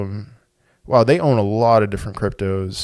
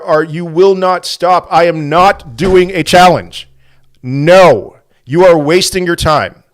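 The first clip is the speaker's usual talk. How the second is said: higher and louder exasperated scolding; 6 semitones higher, 6 dB louder than usual. Real, not acted. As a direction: tell the viewers off, fed up. Scolds viewers sending challenges.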